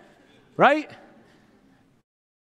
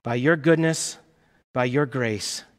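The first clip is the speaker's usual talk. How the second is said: closing prayer; 4 semitones lower than usual. Real, not acted.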